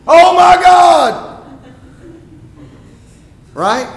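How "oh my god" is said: very high, loud, slow drawn-out wail; mock outrage. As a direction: stretch it like it's the end of the world.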